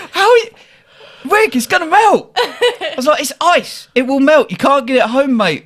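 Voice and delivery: high, incredulous pleading; retold outburst at a clueless drunk mate; exasperated protest. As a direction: argue it high-pitched, half laughing. Exasperated argument with drunk friend.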